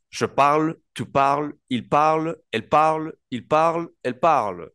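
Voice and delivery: "parle" dicho igual en las cuatro personas, claro y algo agudo; pronunciación francesa modelo. Shown as a demonstration. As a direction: demuestra que "parle" suena siempre igual. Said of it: "cuatro verbos que suenan iguales". (profesor de francés modela "Je parle, tu parles")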